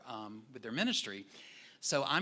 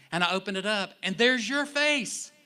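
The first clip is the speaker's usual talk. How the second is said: higher, louder delighted reveal; excited discovery; 8 semitones higher, 9 dB louder than usual. Retold or imitated. Friend telling the pastor he found him online, excited reveal. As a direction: build to the big reveal. Friend retelling seeing his face.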